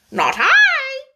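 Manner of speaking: high, quick, flustered bird; hasty Lory. A narrator's reading. The Lory quickly denies speaking to the mouse. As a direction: blurt it out defensively. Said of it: "said the Lory hastily".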